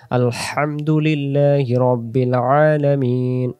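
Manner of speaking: slow Quranic "আলহামদুলিল্লাহি" with hissing h; throaty recitation. Shown as a demonstration. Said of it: "যত জায়গায় লম্বা হা আসবে".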